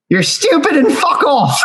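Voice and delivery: high, squeaky bird voice, badly done; bad hummingbird voice. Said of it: "very poor imitation of a hummingbird voice".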